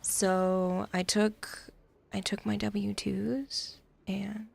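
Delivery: slow soft ASMR whisper. Pretend. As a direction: whisper slowly into the mic. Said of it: "I have to do an A S M R stream about taxes".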